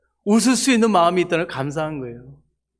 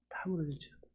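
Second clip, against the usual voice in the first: much softer, whispered repeat of 무너질지어다; whispered echo; 15 dB quieter than usual. Shown as a demonstration. Preacher echoing the prophecy to the church, hushed whisper. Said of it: "repeats prophecy softer".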